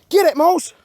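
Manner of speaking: ordinary delivery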